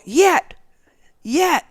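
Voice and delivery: high, loud repeated mock shout; imitated crowd yell. All the kids yell on the count, imitated shout. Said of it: "they all yell".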